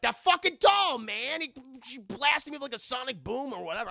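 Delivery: high, loud worked-up complaint; agitated rant. Retold or imitated. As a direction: priest ranting about the doll. Injured priest ranting to John.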